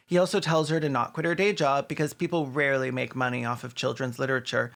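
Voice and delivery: normal talking voice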